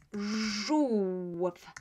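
"żółw" stretched slowly, each sound held; drawn-out demo. Shown as a demonstration. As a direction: hold the zh and oo of "żółw". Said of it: "you already know these three".

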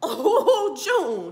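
loud, high laughing "oh"; alarmed amusement. Real, not acted. Viewer reacting to Joseph's look at June, loudly alarmed. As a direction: whoop it as a gleeful warning.

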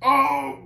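high, loud groan of pain; wounded groan. A narrator's reading. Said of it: "groaned one wounded giant".

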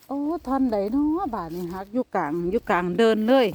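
ordinary delivery

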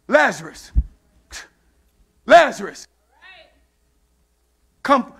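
spaced, building summons; calling out. Acted. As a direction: call someone forth, pausing after each word. Acts Jesus calling Lazarus out.

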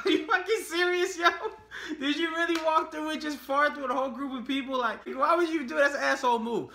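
high, loud, disbelieving; can't believe she did that; incredulous outrage. Real, not acted. Outraged at coworker.

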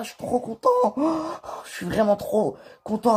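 high, bubbling excitement; giddy prisoner about to walk free; overjoyed. Acted. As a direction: burst with joy at finally getting out.

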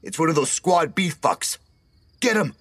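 rally the others to attack; shouting. A narrator's reading. Rival teammate setting others on Emilio, high shout. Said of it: "someone shouted".

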